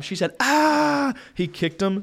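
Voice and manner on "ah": short high yelp; retold scream higher and louder; mock scream. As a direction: yelp as if kicked. Someone kicked, she screams.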